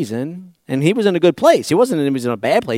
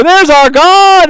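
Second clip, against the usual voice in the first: slow, high, loud cry of delight; crowd's exultant shout; 11 semitones higher, 10 dB louder, much slower than usual. Retold or imitated. The people hailing the golden calf with a slow, loud, high shout. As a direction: hail the golden calf at the top of your voice. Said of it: "And the people go".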